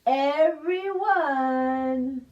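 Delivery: gentle hushed lullaby reading; soothing bedtime. Acted. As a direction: settle the children to sleep. Calm bedtime ending.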